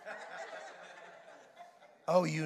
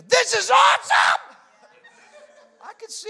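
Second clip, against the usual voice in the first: high, loud, thrilled outburst; a loud, giddy cry of wonder; excited shout; 12 semitones higher, 13 dB louder than usual. Acted. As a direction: blurt it out like an overjoyed disciple. Peter's excited spiritual exclamation.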